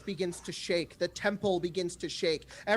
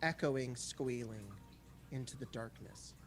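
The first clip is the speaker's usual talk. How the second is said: softer, lower, slowing narration into darkness; hushed scene-setting narration; 5 semitones lower, 8 dB quieter than usual. A narrator's reading. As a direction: let the scene fall quiet and dark. GM describes distant sounds.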